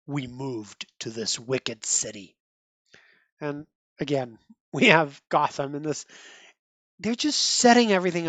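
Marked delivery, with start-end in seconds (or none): 0.1-2.4 low, quiet, grave father's verdict; grim father. Retold or imitated. Dying dad blaming the wicked city, low and grave. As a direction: drop it low like a sentence being passed. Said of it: "the dad says the sentencing phrase".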